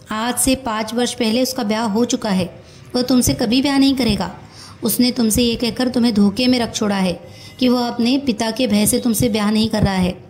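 ordinary delivery, nothing marked

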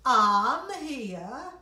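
loud eager fox call. Acted. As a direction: call out brightly as the fox arriving. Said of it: "said the fox".

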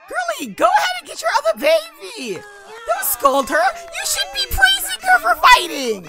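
very high, loud squawk; shrill scolding. Pretend. Gamer telling a parent off over the baby, shrill shouting. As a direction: screech the order, drawing it out.

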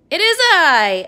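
loud heroic declaration; grand announcement. A narrator's reading. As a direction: proclaim it like a superhero. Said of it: "announced the lady".

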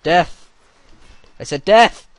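loud, high insistent war cry; battle cry. Pretend. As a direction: yell it again, insisting.

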